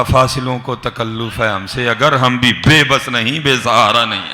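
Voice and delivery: slow, loud, emphatic poetry recitation; stretched-out words at full voice; verse declamation. Real, not acted. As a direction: declaim the couplet to a crowd.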